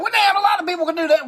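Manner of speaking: high, scoffing doubter's voice; imagined skeptic. Retold or imitated. Host mocking a skeptical viewer, scoffing. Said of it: "you probably think".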